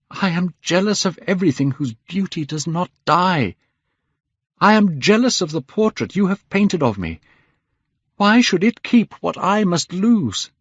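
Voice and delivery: high, impassioned complaint; anguished jealousy. A narrator's reading. Dorian jealous of his own portrait, anguished. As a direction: build to a high, bitter plea.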